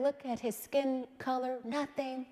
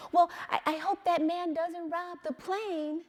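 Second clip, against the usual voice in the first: put-on higher, louder little boy repeating himself; child's voice; 7 semitones higher, 5 dB louder than usual. Mother quoting her son repeating it, higher child voice. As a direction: innocent child saying it again. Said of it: "He says".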